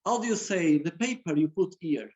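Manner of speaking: normal talking voice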